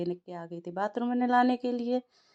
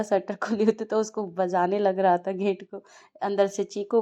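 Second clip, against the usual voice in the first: usual speaking voice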